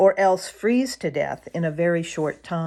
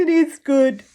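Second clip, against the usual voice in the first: mumbling; 9 semitones higher, 7 dB louder than usual. Acted. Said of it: "mumbled Drummer".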